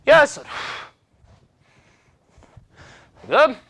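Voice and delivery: 한 번씩 힘차게 외치는 구령; counting calls. Real, not acted. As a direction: bark out every number to drive the set. Instructor counting out kicks.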